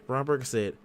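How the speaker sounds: ordinary talk, nothing marked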